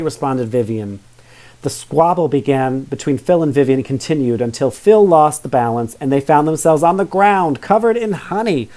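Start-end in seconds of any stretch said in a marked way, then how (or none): none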